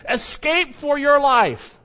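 loud, high, slow urgent warning; urgent command. Acted. Angel warning Lot to flee town, urgent loud command. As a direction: sound the alarm slowly, word by word.

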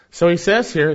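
normal talking voice

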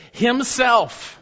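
slow, ringing "himself"; stress on himself. Shown as a demonstration. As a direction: lean hard on "himself" as the emphatic word. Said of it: "It's emphatic in the original language".

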